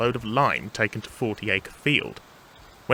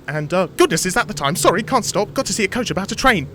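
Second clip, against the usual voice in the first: high, louder, racing to a fast finish; flustered gabble; 9 semitones higher, 5 dB louder than usual. Acted. Thomas breaking off to flee Toby, flustered. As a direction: rush through excuses to escape.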